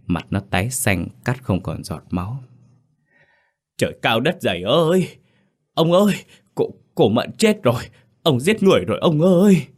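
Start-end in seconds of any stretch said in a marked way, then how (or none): 3.8-9.7 high, loud panicked wailing; horrified shriek. A narrator's reading. Servant finding the woman dead, shrieking to his master. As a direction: cry out in horror, stumbling mid-way. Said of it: "Rồi nó rú lên một tiếng".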